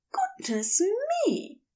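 light, high fussy alarm; startled Aziraphale. Acted. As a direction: gasp it out in surprise.

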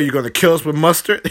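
fast and laughing through it; mock him while cracking up; laughing mockery. Mocking the hamburger, laughing.

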